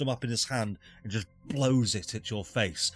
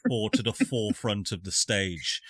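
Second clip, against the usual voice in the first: ordinary delivery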